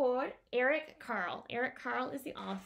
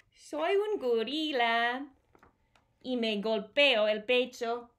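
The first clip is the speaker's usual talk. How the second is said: usual speaking voice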